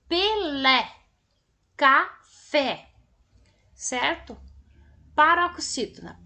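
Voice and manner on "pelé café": Pelé and café split, final syllable punched; pe-LÉ, ca-FÉ, loud on the last beat; teacher's syllable-by-syllable Pelé, café, hitting the endings; stress on last syllable. Shown as a demonstration. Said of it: "as oxítonas têm a última sílaba tônica".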